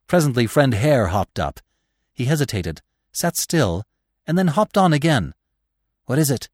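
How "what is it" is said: ordinary delivery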